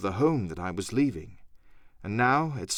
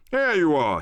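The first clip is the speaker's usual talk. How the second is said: louder, cheerful hail; cried out; 3 semitones higher, 4 dB louder than usual. A narrator's reading. Squire greets Jim on arrival, cheerful cry. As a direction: call out gladly to arriving guests. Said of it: "he cried".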